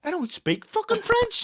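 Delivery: exasperated retort. Retold or imitated. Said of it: "And I was like".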